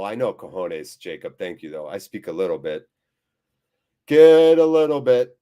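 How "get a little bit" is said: loud playful call. Real, not acted. Streamer bellowing a chat phrase back at viewers, loud. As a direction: belt it out, much louder.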